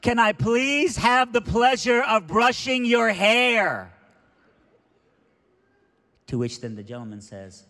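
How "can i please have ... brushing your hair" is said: very loud, high, drawn-out shout; yelling to be heard. Retold or imitated. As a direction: holler slowly so the whole gate hears. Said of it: "she's gotta yell so everyone at the gate can hear".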